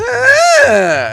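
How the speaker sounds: high shouted mock bellow; cow-like shout. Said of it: "Impression of Laura Sanko versus Cow Ariel".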